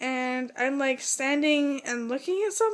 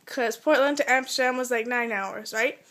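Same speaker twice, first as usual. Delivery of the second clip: ordinary talk, nothing marked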